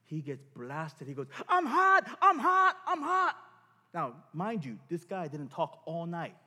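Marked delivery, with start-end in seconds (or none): 1.5-3.3 high, loud cry of I'm hot, over and over; retold outcry. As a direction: call it out like heat is rushing through you. Healed guy suddenly cries out.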